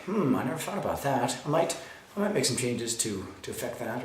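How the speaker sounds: soft, thoughtful response to criticism; centered, unruffled. Acted. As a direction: take it in calmly and quietly. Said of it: "they stay centered".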